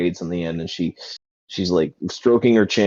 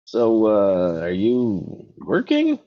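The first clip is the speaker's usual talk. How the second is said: lightly voiced gnome woman, higher pitched; higher, drawn-out questioning at the end; Girthwood; 6 semitones higher, slower than usual. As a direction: play the gnome bartender, pitched up a little. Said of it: "And Girthwood's like".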